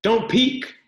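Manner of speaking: high, loud playful call; playful cry. Acted. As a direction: call out like a girl hiding. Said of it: "She laughed and cried".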